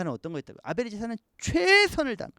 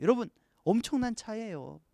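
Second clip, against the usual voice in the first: ordinary talk, nothing marked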